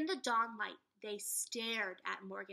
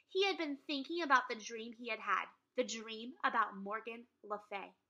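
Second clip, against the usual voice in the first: story narration; 5 dB louder than usual. A narrator's reading. Reading chapter to class.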